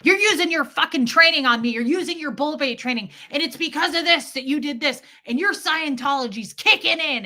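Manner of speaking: high, loud and fast mimicking of her husband; mocking husband. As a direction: throw his accusation back, mockingly. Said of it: "that guy would go".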